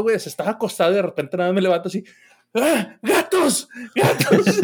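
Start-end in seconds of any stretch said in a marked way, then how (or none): 2.5-4.6 high, drawn-out startled shout; half-asleep alarmed cry of "¡Gatos!"; startled yell. Retold or imitated. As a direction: yell at the cats, jolted awake. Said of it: "tu pinche grito de".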